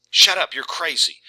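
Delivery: loud, sharp mock shout; frightened retort. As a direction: push him away with your voice. Connie yelling at Arnold.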